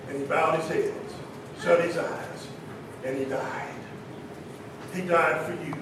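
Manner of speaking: low, very soft, reverent preaching; solemn hush. Real, not acted. Preacher telling the congregation that Jesus died, solemn hush. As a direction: drop right down to a hushed, grave tone.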